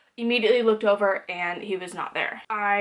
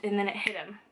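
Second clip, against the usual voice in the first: ordinary delivery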